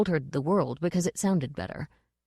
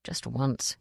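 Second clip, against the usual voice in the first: soft, low private plea; hushed, wishful muttered thought; inward thought; 6 semitones lower, 6 dB quieter than usual. A narrator's reading. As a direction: think it quietly to yourself. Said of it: "he thought".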